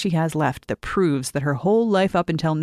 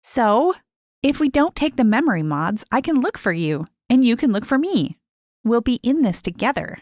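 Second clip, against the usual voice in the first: put-on higher girl's voice, eager and conspiratorial; young girl; 6 semitones higher than usual. A narrator's reading. Lise showing Mary how to hide the pill, eager. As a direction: coax a friend into a secret plan.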